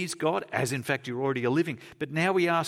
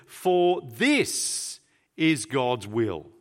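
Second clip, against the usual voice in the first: very slow, every word weighted, FOR leaned on; emphatic scripture line, slowed right down; 4 dB louder, much slower than usual. Shown as a demonstration. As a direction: drag out "For this is God's will" with heavy emphasis on "For". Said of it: "It's very emphatic".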